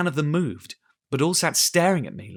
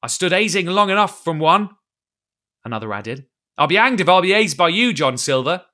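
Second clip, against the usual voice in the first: louder, higher, resentful; pirate defying his captain; defiant anger; 5 semitones higher, 7 dB louder than usual. A narrator's reading. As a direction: throw it back at the captain. Pirate defies Silver.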